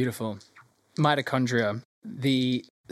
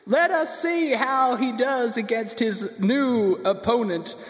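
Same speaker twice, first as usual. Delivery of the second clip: high, slow, building arena introduction; ring announcer; 10 semitones higher, slower than usual. Acted. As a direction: stretch the fighter's name into a final shout.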